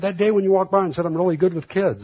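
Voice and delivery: mild mock angry retort, high and quick; accusing. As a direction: throw the words back at him. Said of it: "He said".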